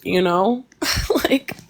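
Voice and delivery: usual speaking voice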